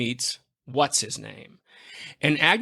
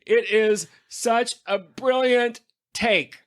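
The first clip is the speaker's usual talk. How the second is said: normal talking voice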